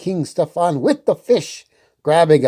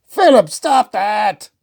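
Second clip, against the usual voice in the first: a touch of flustered protest; sputtering; 7 semitones higher, 3 dB louder than usual. Acted. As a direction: protest while being twirled. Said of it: "King Hubert sputtered".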